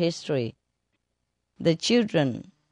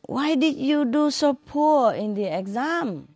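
stern teacher; 8 semitones higher than usual. Retold or imitated. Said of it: "Teacher say to student".

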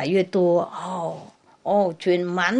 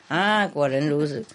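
ordinary delivery

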